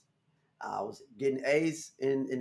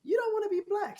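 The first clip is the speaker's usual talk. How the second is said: high mock-mom voice; a high, exaggerated mom impression. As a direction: play his mom, pitched way up and teasing. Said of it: "my mom was like".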